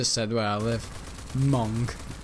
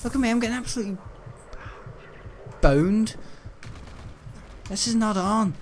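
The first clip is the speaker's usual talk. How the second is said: mock dismay; 9 semitones higher than usual. Getting beaten in game.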